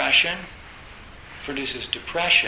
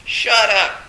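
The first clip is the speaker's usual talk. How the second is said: loud, higher shouted shut up twice; mock furious shout; 6 semitones higher, 9 dB louder, much slower than usual. Zen monk asked a question, shouting back in fury. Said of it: "he says".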